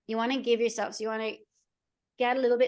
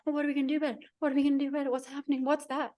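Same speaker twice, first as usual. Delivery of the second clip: put-on higher-pitched, anxious little-kid voice; freaking-out toddler; 4 semitones higher, much faster than usual. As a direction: mimic a fretful toddler firing off worried questions. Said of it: "the toddler's like".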